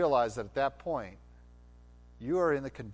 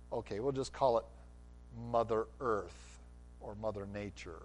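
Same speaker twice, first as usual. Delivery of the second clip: ordinary delivery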